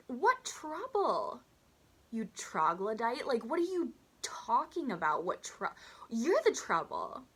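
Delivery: high, drawn-out incredulous outburst; indignant disbelief. Real, not acted. Teller answering Botox Lady's demand, indignant.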